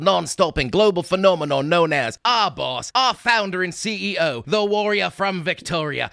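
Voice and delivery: put-on Simpsons Australian accent. Co-host introducing the birthday boy, Simpsons Australian accent. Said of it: "That was the Simpsons Australian accent".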